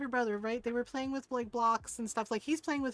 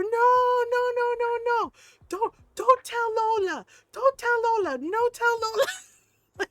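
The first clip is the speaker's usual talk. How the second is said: put-on high, loud, panicked kid pleading; screaming child; 8 semitones higher, 8 dB louder than usual. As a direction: imitate a child shrieking to stop a tattle. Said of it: "and screams at her".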